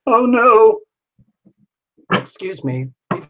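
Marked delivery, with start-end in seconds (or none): 0.1-0.8 mock panic.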